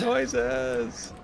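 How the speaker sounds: high, drawn-out singsong echo; mimicked silly cry. Player echoing a friend's joke about noises, silly high cry. Echoes the mock cry higher.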